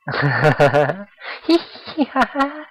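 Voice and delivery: high, loud cartoon giggle; acted giggle. Bubbly girl laughs at new student, high giggle. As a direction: giggle loudly and high in character.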